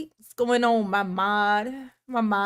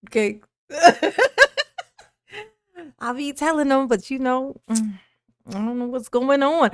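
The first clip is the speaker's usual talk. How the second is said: ordinary talk, nothing marked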